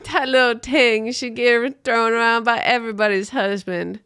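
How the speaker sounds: half-joking Jamaican patois lilt; mock Jamaican patois. Mocking the pop star's many husbands in playful Jamaican patois. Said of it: "It kinda sounds like I'm doing patois".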